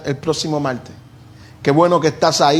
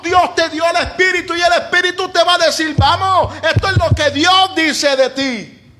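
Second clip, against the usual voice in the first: fiery preaching; 11 semitones higher than usual. Acted. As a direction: preach it to the congregation with fire. Preaching at full force. (predicación enérgica y apasionada)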